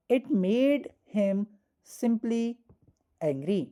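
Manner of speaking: each word laid out very slowly; slow dictation. Shown as a demonstration. Teacher dictating the example sentence slowly to students. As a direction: dictate the sentence at writing speed.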